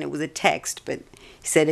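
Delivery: ordinary talk, nothing marked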